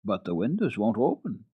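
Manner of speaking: ordinary delivery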